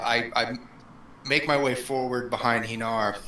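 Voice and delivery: normal talking voice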